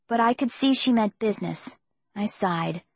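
ordinary delivery, nothing marked

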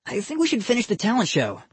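put-on a kid eager to get on with it; young boy voice. A narrator's reading. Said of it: "he said".

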